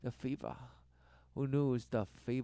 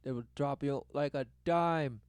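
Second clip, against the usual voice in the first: normal talking voice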